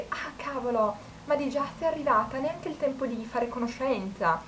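hammed-up letdown flirt; mock disappointed guy. Guy disappointed she arrived.

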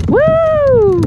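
high, loud whoop of delight; excited whoop. Real, not acted. Angler whooping as a fish flops under his seat. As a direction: let out a thrilled woo.

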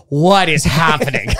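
slow, laughing bafflement; stunned by what you're watching; baffled. Retold or imitated. Viewer of the Beatles video, laughing and baffled. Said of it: "and you're like".

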